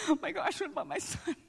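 high, squeaky whispered worry; mock panic. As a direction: fret in a small high voice. Mimics panic at the onslaught.

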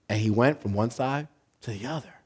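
usual speaking voice

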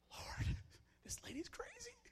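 high whispered plea to God; whispered complaint. Acted. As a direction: whisper it up to heaven in alarm. Old man complains privately to God.

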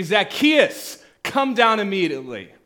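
loud call up into a tree. Acted. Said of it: "he says up in that tree".

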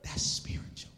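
hushed breathy whisper of awe; "That's spiritual." fully whispered, almost no voice. Real, not acted. Whispered, then repeated aloud.

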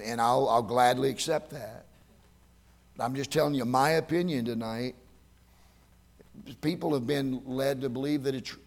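ordinary talk, nothing marked

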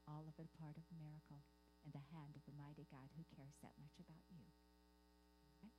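low, soft and earnest, almost confiding; hushed earnest sermon. Real, not acted. Preacher to his flock, hushed and earnest. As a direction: lean in and hush it for the congregation.

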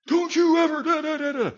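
shrill high shouted warning; angry parent shouting at a child, high and loud; parent losing it. Retold or imitated. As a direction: yell it like a parent who's lost it. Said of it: "you've yelled at them with that tone".

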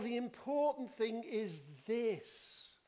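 slow, high, insistent delivery; emphatic declaration. Shown as a demonstration. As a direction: drive home "the important thing is this". Voices an emphatic suggested translation.

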